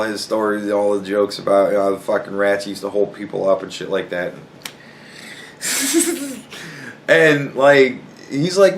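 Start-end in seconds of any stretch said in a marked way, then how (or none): 5.6-6.4 high wheezy snicker; high, soft giggle. Real, not acted. Laughs at the rat jokes.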